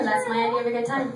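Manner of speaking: normal talking voice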